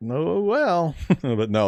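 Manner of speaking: ordinary delivery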